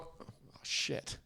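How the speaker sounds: breathy, near-whispered reaction; softly whispered mock awe; hushed mock amazement. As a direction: whisper it under your breath, stunned. Mock awe at friend's Spanish reply.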